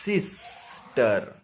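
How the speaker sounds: Sister stretched very slowly; slow motion. Shown as a demonstration. Teacher slowing sister so students hear the S. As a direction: draw sister out sound by sound. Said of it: "आराम से इसको slow motion में".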